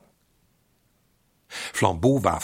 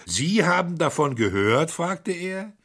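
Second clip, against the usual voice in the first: higher, louder, startled questioning; sudden interest; 9 semitones higher, 6 dB louder than usual. Acted. The criminal presses the priest, startled and curious. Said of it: "mit plötzlichem Interesse".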